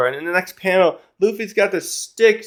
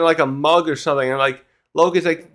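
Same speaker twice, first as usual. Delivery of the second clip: normal talking voice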